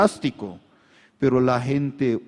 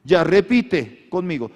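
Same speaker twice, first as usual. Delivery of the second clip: fervent preaching; 6 semitones higher, 9 dB louder than usual. Acted. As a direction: make them repeat it boldly. Preaching, congregation repeats. (declaración enérgica)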